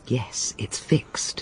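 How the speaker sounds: hushed, slow whisper; a secretive whispered confirmation. Acted. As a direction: breathe it so no one overhears. Secret call to conspirator.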